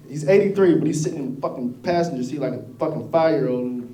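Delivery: normal talking voice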